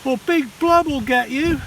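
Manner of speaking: high, loud teasing warning; spooky warning. Acted. Tadpole siblings scaring Tad, high mock warning. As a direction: wave a scary threat at the little one. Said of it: "they would say".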